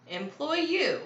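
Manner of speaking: high-pitched doubtful question; frowning question. A narrator's reading. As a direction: ask it in disapproving disbelief. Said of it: "asked with a frown".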